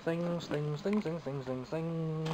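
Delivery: ordinary talk, nothing marked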